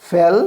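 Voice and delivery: फेल with a brief "eh"; short-vowel example फेल; short E sound. Shown as a demonstration. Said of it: "short sound है".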